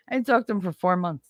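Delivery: ordinary talk, nothing marked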